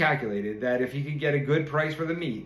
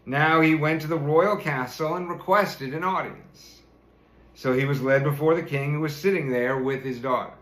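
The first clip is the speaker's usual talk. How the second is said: ordinary delivery, nothing marked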